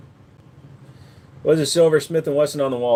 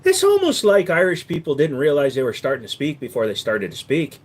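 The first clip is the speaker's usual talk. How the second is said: ordinary talk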